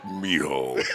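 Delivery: very low gruff father calling his son; character catchphrase. Pretend. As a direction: drop low for a stern dad's call. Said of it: "do your variation of boy in different languages".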